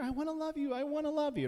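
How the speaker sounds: very high sweet sing-song; eager coaxing. Pretend. Preacher playing someone begging a cat for affection, high sweet coaxing. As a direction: beg to cuddle it, sweetly and high.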